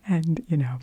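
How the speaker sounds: ordinary talk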